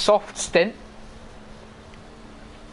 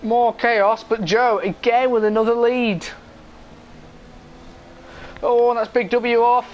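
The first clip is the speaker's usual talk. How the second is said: motorsport commentator; 5 semitones higher than usual. Real, not acted. Live race play-by-play.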